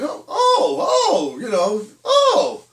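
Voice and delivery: very high, loud fake "oh"; hammed-up innocent amazement; feigned surprise. Parents pretend to their daughter they never watched, feigned surprise. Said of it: "We just pretended".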